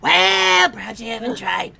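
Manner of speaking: put-on high, nasal old witch; cackling hag teasing; crone voice.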